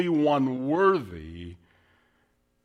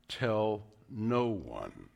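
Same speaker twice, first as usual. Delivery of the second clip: slow hush; 7 semitones lower, 7 dB quieter, much slower than usual. Retold or imitated. Jesus tells deaf man.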